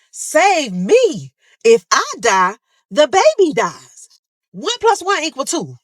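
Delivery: high, loud desperate plea; retold scream. Shot patient screaming at nurses to save her. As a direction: shout at the nurses, begging. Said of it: "I'm screaming to them".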